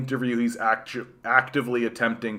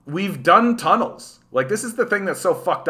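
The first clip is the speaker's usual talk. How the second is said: exasperated; 7 semitones higher than usual. Real, not acted. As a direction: hammer it home, fed up. Exasperated rant about tunnels.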